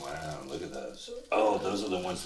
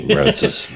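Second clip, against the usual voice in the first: high squeal; 12 dB louder than usual. Acted. Dog makes itself heard.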